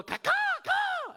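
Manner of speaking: volley of high crow calls; very high mock bird cries; imitated crow caws. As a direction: caw back like a chattering crow. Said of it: "And then they'll go".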